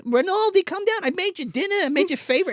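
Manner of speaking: sing-song like a scheming mother; high syrupy mom calling down. Retold or imitated. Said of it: "his mother's like".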